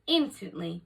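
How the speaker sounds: usual speaking voice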